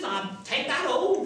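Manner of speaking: put-on high, delighted old countrywoman; old woman. Washerwoman decides to take the gold home, high old-woman delight. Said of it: "how old and worn out she is".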